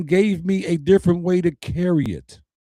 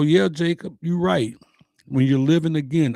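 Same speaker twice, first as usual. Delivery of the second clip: ordinary talk, nothing marked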